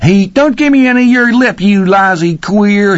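high, loud, scornful cook voice; angry cook. A narrator's reading. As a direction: spit the insult back. Cook snapping back.

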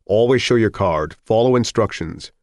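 brisk official orders; instructing doctor. A narrator's reading. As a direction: call out the rules to a line of men. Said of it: "the doctor with the clipboard was instructing".

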